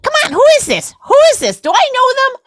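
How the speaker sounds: hammed-up husband begging to know; mock frantic husband. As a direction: squeal like an impatient husband. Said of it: "I don't wanna stop".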